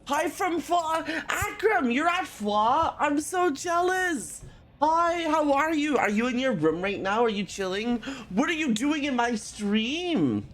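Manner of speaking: high, bright chatty greeting; excited greeting. Real, not acted. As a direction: greet the chat with delight. Greeting chat in stream.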